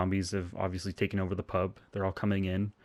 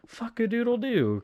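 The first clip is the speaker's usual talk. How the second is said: high, slow, put-on cry of dismay; dismayed exclamation; 3 dB louder than usual. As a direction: drag it out in a high mock wail. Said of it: "he's just like".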